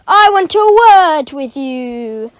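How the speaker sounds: loud slow menacing demand; angry demand. Acted. Caretaker cornering the boy, loud slow menace. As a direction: lean in on every word.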